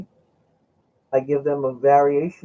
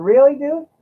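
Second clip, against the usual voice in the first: high, loud "really, dude?" in disbelief; disbelieving surprise; 7 dB louder than usual. Retold or imitated. To the friend who paid a hundred dollars, loud high disbelief. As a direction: react like a friend just overpaid you.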